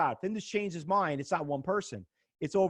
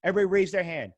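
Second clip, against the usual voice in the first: ordinary talk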